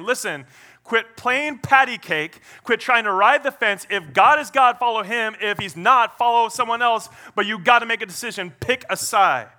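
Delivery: preacher voicing an impatient prophet's challenge; forceful challenge. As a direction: press a wavering crowd to decide. Voices Elijah challenging the people.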